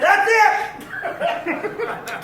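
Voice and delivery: mock-furious mom, pitched high; mom snapping. Mom fed up with lice, hammed-up high snap. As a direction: blurt it out like a mom at her limit. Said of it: "One day she just snapped".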